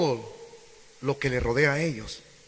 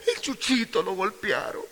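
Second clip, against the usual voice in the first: mock tearful whine; 5 semitones higher, 5 dB quieter than usual. Imitating a tearful person.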